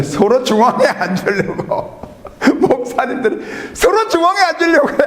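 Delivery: high, laughing voice breaking up on the words; laughing while telling. Real, not acted. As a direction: laugh helplessly at the absurd seat contest. Laughing at pastors vying for seats. (웃음을 못 참으며 말하기)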